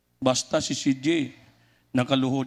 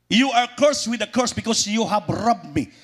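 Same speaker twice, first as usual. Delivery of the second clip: booming scripture quote, raised pitch; preaching scripture louder; 7 semitones higher, 3 dB louder than usual. Acted. Preacher to congregation, booming the curse verse. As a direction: thunder out the verse like a prophet. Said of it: "Double curse".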